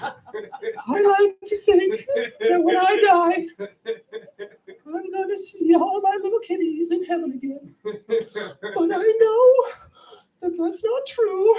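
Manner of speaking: high, tearful, laughing-crying whine; mock-sobbing teacher pining for her kitties; hammed-up weepy voice. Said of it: "who said this with tears streaming down her cheeks".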